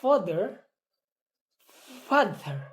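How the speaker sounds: usual speaking voice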